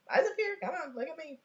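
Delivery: high, sing-song summons; coaxing for attention. Acted. Adult calls child to pay attention, coaxing. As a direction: coax a distracted child to focus.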